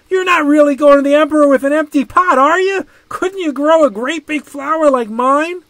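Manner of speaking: high, loud, fast mocking kid voice; taunting child. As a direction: tease him like a show-off kid. Friend taunts Ping.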